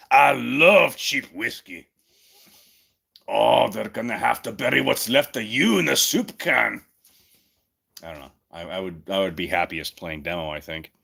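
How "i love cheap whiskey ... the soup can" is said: Demoman voice. Acted. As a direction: ham up a drunk demolitions man. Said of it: "I'd probably be stuck as Demo Man".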